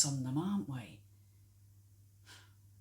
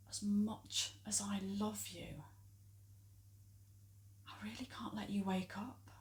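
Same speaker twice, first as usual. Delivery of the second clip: soft, half-whispered regret; hushed confession; 8 dB quieter than usual. Acted. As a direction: break it to a dear friend gently and quietly. Gentle confession at friend's bedside.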